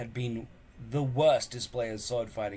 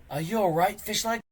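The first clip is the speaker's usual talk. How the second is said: concerned; 10 semitones higher, 5 dB louder than usual. Acted. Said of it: "asked Hiccup with concern".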